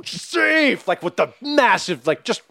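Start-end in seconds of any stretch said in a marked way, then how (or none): 0.0-0.9 high, drawn-out dramatic safe call; dramatic umpire call. Acted. Host imitates a home plate umpire, dramatic call. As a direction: stretch it high like a theatrical umpire. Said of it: "do the really dramatic".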